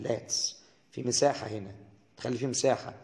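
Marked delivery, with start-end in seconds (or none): 0.0-0.5 "let's" said light, soft and delicate. Shown as a demonstration. Teacher showing students a light, delicate "let's". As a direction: breathe out a soft, airy "let's". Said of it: "بتخلي الصوت خفيف كده ورقيق وناعم".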